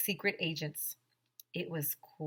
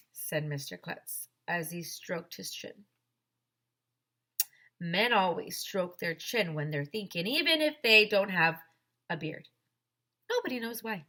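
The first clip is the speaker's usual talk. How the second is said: usual speaking voice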